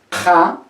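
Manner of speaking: ja as a hard stop; uvular stop. Shown as a demonstration. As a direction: stop ja at the back. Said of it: "Noten la diferencia".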